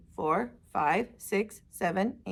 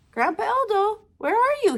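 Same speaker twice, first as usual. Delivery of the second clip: high, louder call for grandpa; 11 semitones higher, 4 dB louder than usual. Acted. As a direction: call out across the field. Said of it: "he called".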